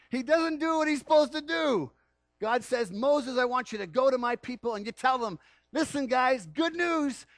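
high, loud, eager announcement of good news; booming joyful divine message; excited God voice. Acted. Preacher voicing God's commission.

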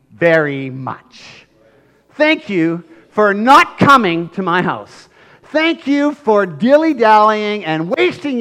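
slow, loud, bitter sarcasm; sarcastic thanks. Retold or imitated. Angry father thanking Jesus with slow loud sarcasm. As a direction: drip sarcasm over each thanks. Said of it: "looked at Jesus and go".

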